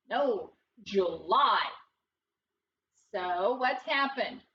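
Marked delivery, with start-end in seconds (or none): 0.8-1.6 "July" slowly, second syllable stressed; accent on the end. Shown as a demonstration. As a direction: say "July" as joo-LIE, stretching the end. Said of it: "putting our accent on the end".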